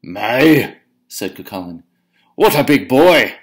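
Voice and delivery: bully giant bragging to Una, booming. Acted. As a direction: show off the flattened thunderbolt proudly. Cucullin voiced.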